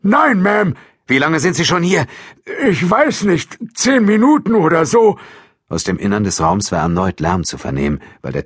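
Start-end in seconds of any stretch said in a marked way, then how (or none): 0.0-0.7 loud, high reply; high-voiced alarmed caretaker; flustered old janitor. A narrator's reading. Startled janitor answers the officer. 1.1-2.0 raised, high voice; loud, insistent question; urgent questioning. A narrator's reading. Officer urgently questions the janitor. 2.7-5.2 high, loud, rattled answer; high-pitched, loud and uncertain; flustered old janitor. A narrator's reading. Startled janitor answers the officer.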